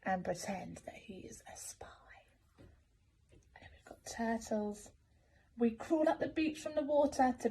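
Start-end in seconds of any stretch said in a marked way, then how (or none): 0.1-1.9 hushed, breathy spy whisper; sneaky whisper. A narrator's reading. Reader to child, whispering the spy crab's secret. 2.6-4.9 slow, soft whispered aside; hushed whisper. A narrator's reading. Reader to child, slow whisper introducing the turtles.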